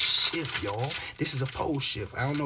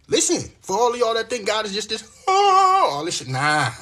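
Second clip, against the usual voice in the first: 1 emotional outcry; 9 semitones higher, 7 dB louder than usual. Real, not acted. Emotional outburst over God.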